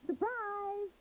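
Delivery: high, bright cry of surprise; excited surprise. A narrator's reading. Parents surprising Slavka, high excited cry. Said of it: "cried her parents".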